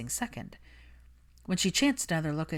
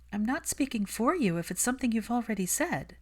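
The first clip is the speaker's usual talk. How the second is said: put-on lightly raised, brisk young woman; Hermione's voice; 6 semitones higher than usual. Hermione brushing off Malfoy, cool and defensive. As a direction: keep her cool and a little defensive.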